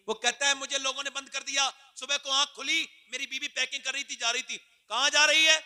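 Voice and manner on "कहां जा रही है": loud, high demanding question; angry shout. Acted. Drunk husband yelling at his packing wife. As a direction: bark it at her as she leaves.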